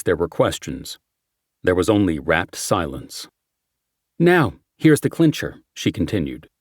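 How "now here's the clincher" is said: lightly raised, brisk detective; brief the team like a cop. Acted. Character briefing quote.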